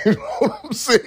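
high, laughing through the question; can't hold the laugh; giggling high. Real, not acted. Laughing at the stink image.

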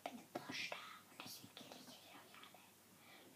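hushed, secretive whisper; conspiratorial whisper. Real, not acted. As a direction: whisper the evil plan. Secret imposter plan whispered.

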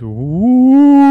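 loud, swelling ghost howl; long "ooh" rising into a high wail; ghostly wail. A narrator's reading. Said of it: "all she could hear is".

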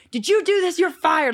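high, loud mock outrage; joking accusation. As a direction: pretend to fire your friend, playfully indignant. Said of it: "I'm joking with him".

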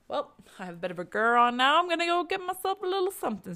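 high, fast, breezy mock voice of a killer; mock casual killer. Mimicking a killer casually planning a snack, high and breezy. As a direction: toss it off like a murderer planning a snack. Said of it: "and they're like".

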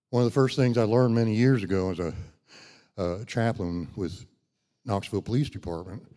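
ordinary talk

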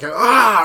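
loud, high demonstrated "aah"; mock hurt holler; own pain yell. Shown as a demonstration. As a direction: show how you yell when hurt. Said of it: "That's different than my yell".

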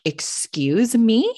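drawn-out, rising incredulous question; indignant disbelief. Retold or imitated. Acted disbelief at bench girl.

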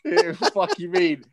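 slow, laughing disbelief; chuckling it out. Real, not acted. Laughing at own joke.

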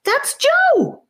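very high, delighted cry; boy recognises his brother, thrilled; excited shout. A narrator's reading. Boy hears his brother's voice, high excited shout. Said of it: "Charlie shouted".

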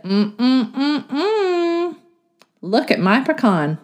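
high, humming fox; a proud little fox showing off. A narrator's reading. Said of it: "The fox in the clubhouse said".

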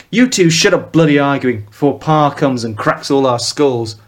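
Mancunian scolding. Acted. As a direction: snap at the two in a Mancunian accent. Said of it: "his dialect a carbon copy of Elvis".

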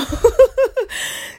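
loud, high burst of laughter; short, loud cackle mid-rant. Real, not acted. Rebuffing a would-be boyfriend, laughing loudly. Laughs while listing objections.

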